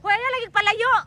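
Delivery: high-pitched call over distance. Real, not acted. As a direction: call after them as they drift off. Calling to people drifting away. (taas nga singgit)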